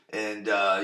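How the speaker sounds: normal talking voice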